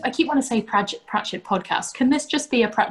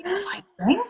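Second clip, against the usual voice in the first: whisper; 12 semitones higher than usual. Real, not acted. Excited whisper.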